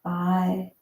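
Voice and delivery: ordinary talk, nothing marked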